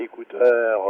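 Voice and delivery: ordinary delivery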